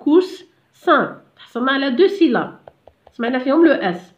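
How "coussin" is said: "Coussin" in two parts with a pause before "sin"; two separate syllables in "Coussin"; split into syllables. Shown as a demonstration. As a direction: break "Coussin" after the s sound. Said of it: "نقسموها، قلنا هنا en deux".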